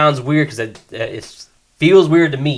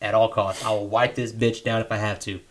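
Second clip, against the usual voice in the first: low, hushed inner monologue; private resolve; 5 semitones lower, 8 dB quieter than usual. Acted. Guy deciding he needs to keep her, low private resolve.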